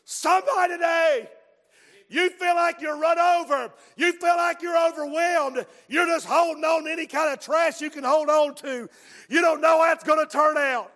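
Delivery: loud, high preacher's call; preaching at full force. Acted. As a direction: drive it home to the church.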